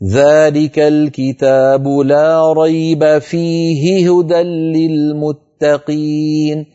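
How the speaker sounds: slow sung Quran reading; Quran recitation. Shown as a demonstration. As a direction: chant the verse slowly and melodiously. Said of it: "تأملوا قوله تعالى".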